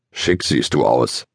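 a low, slow teasing rumble; deep, drawn-out grumbled tease; grumbling. A narrator's reading. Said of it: "brummelte es vom Zaun her".

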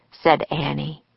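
ordinary delivery, nothing marked

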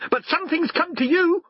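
cheeky answer in a very high voice; saucy dog. A narrator's reading. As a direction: talk back as a squeaky little dog. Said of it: "Garm answered him saucily".

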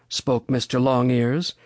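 ordinary delivery, nothing marked

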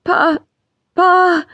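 put-on a girl wailing to her father. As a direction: cry out to him begging. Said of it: "she wailed".

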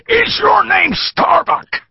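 put-on slow, very high, loud drunken shout; shouted question. A narrator's reading. Bearded thug shouting at the captive, slow and very high. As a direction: bellow it right in his face. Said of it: "He shouted the question".

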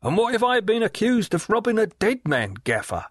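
high, sly leering call across the water; leering waterman. Acted. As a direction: Riderhood hailing Gaffer with a sly leer, pitched high. Riderhood's voice held.

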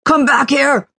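high, loud, raging shout; furious yell. Acted. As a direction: roar after her in fury. Villain yells after fleeing woman.

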